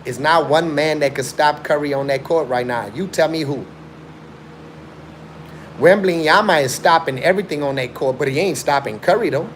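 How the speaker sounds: ordinary talk, nothing marked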